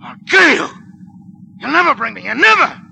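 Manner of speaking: high loud enraged yell; furious threat. Acted. Cornered gangster screams threats at soldier. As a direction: scream defiance.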